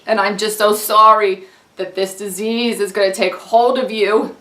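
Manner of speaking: put-on gush, then reassure in Long Island accent. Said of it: "Long Island".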